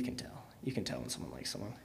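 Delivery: soft unvoiced confiding aside; whispering. Real, not acted. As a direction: lean in and whisper the secret. Confiding gossip about crush.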